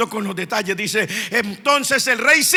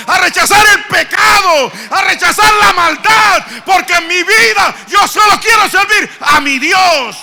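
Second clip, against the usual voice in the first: fiery preaching; 8 semitones higher, 7 dB louder than usual. Acted. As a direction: preach it, building to a loud, high shout. Preaching builds to shouting. (predicación encendida, a gritos)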